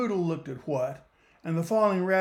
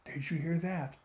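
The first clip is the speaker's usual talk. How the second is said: softer, hushed question; whispered question; 8 dB quieter than usual. Acted. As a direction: ask it softly, afraid to be heard. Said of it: "Poodle whispered".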